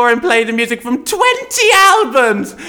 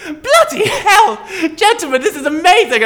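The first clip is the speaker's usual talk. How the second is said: amazed; 9 semitones higher than usual. Acted. Amazed at capacity.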